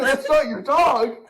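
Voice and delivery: stunned, laughing disbelief; slow and laughing, can't believe it; incredulous laugh. Retold or imitated. Worker to kid, laughing in disbelief.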